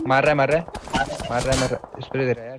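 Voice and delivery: loud, urgent yelling while under fire; panicked shouting. Real, not acted. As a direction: shout it, you're being shot right now. (घबराकर ज़ोर से चिल्लाना)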